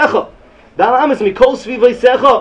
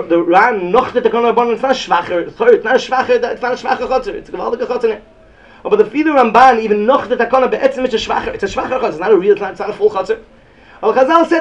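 usual speaking voice

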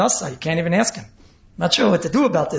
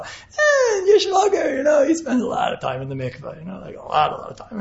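a busybody relative, pitched high and cried out; mock gossip; 7 semitones higher than usual. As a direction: squeal it out like a juicy gossip, fading to a hush. Mocking the gossiping brother-in-law.

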